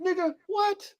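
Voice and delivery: high, incredulous "what?". Retold or imitated. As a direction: play the breeder in disbelief. Breeder reacting to lowball buyer.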